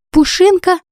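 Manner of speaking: high delighted cry of recognition; surprised cry. Acted. Heroine spotting her dog, high surprised cry. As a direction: call out the dog's name, suddenly overjoyed.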